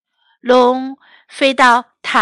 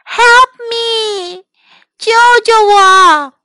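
high tearful call for help; cry for help; 9 semitones higher, 6 dB louder, slower than usual. Acted. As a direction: wail for rescue. Princess calls for help.

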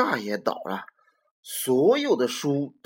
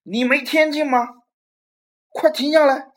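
much higher, loud frantic cry; frantic yell; 12 semitones higher, 9 dB louder than usual. Acted. Robert frantically yells at the monster to stop, loud. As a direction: shout desperately for him to stop. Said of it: "罗伯这可急坏了".

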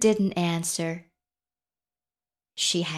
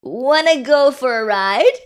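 higher, loud horse character, lifting at the end; raised voice with a big questioning rise; storybook horse; 5 semitones higher, 7 dB louder than usual. A narrator's reading. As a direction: invite eagerly as the horse. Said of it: "said the horse".

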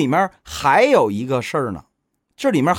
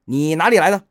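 forced brave shout; 7 semitones higher, 3 dB louder than usual. A narrator's reading. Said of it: "于是妖精硬着胆喝道".

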